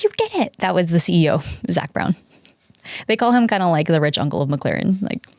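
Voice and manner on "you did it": mock scream. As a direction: imitate the ecstatic scream lightly. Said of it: "the guy who screamed".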